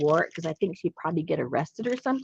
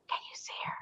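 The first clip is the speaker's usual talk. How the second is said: hushed, voiceless question; whisper; 14 dB quieter, much faster than usual. Real, not acted. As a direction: whisper it as if on a solemn occasion. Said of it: "Why are we whispering?".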